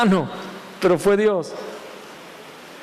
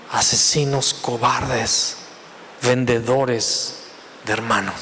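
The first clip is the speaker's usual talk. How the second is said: stretched slow and low, word by word; slow emphatic; 9 semitones lower, 3 dB quieter, slower than usual. Shown as a demonstration. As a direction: drag each word out in shame. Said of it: "preacher slows for emphasis". (lento y grave, palabra por palabra)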